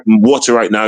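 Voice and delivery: normal talking voice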